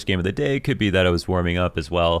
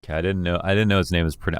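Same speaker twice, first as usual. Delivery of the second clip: normal talking voice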